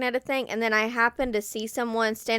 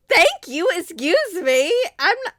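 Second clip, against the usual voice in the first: higher and louder, petulant; mock indignant threat; 5 semitones higher, 5 dB louder than usual. To someone who didn't say thanks at the door, a petulant mock threat. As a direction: scold the rude door-walker.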